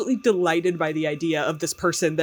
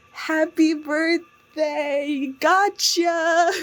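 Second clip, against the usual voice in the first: high, slow, sing-song happy birthday; cheery prank voice; 12 semitones higher, much slower than usual. Retold or imitated. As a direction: gloat sweetly like a prankster on tape. Coworkers' cheery prank tape voiced.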